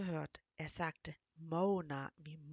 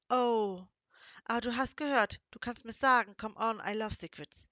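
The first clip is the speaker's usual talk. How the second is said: louder, higher, teasing plea for the secret; playful pleading foreigner; 3 semitones higher, 10 dB louder than usual. Acted. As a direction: coax her like a curious boy. Male character pleading playfully. (neugierig bettelnd, laut und verspielt)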